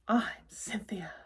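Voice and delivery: slow, raspy whispered monster voice; slithery raspy monster introduction; rasping. Acted.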